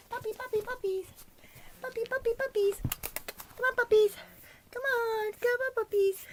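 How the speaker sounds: high sing-song puppy call; calling puppies. Real, not acted. Owner calling puppies to come, high and loud.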